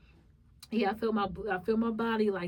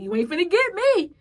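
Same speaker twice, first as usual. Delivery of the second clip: loud, high defiant vow; 8 semitones higher, 11 dB louder than usual. Retold or imitated. Telling her flesh it won't win, loud and defiant. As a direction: declare it to the devil, louder. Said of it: "That's how my thought was".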